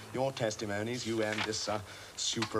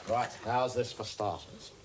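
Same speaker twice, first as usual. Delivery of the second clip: usual speaking voice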